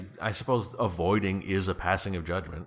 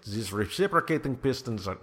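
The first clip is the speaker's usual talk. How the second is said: badly faked German accent, pitched higher; botched German accent; 4 semitones higher than usual. Podcaster plays the bothered engine inventor, failed German accent. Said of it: "it's not a German accent".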